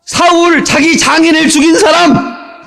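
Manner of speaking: brand him like a public herald; hammed-up accusing announcement; mock proclamation. Imagined résumé label proclaimed.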